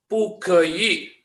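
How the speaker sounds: pugeyi drawn out in separate syllables, pu-ge-yi; syllable-by-syllable reading. Shown as a demonstration. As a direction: say "pugeyi" slowly, one syllable at a time. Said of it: "G E Y I".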